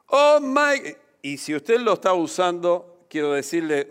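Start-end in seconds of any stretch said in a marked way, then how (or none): 0.1-1.0 high, loud mimicked exclamation; mock surprise. Preacher mimicking the expression he asks people to drop, mock surprise. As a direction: imitate a shocked "oh my". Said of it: "una expresión de sorpresa".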